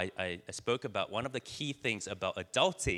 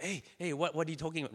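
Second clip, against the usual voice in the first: eager butting in; 7 semitones higher, much faster than usual. Retold or imitated. Acting out fomo to friends.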